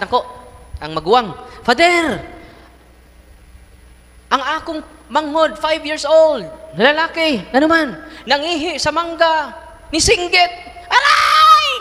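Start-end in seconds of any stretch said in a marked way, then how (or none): 1.6-10.5 much higher, pleading to the priest; a kid anxiously reporting to Father; high, loud, pleading child; high worried child voice. Acted. Voicing worried older sibling. 10.9-11.7 very high shrieked ouch; high, loud ouch; pained child yelp. Acted. Child cries out in pain.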